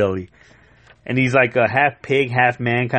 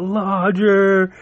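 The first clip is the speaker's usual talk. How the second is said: crying out; 7 semitones higher than usual. Retold or imitated. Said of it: "he cries out".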